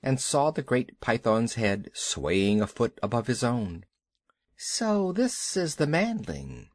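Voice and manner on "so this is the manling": slow, high-pitched python voice; Kaa. A narrator's reading. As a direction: size up the boy slowly. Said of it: "said Kaa".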